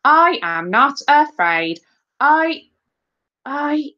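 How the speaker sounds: slow declaiming that stalls on a high "I"; faltering school-play actor; trailing into silence. A narrator's reading. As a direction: recite boldly, then lose the line and fade. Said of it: "Elvis's voice trailed into silence".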